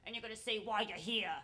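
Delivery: lightly voiced smoky older woman; smoky-voiced host. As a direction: run through the rules like a haggard, smoky host. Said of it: "she's got, like, this smoky voice".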